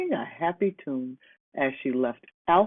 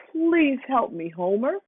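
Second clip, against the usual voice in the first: howled plea; 9 semitones higher than usual. A narrator's reading. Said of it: "Hannah howled".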